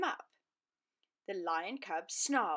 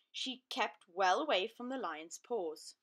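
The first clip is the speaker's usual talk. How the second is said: plain reading voice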